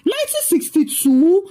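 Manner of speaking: high rising shocked question; incredulous scream. A narrator's reading. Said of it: "Liz screamed".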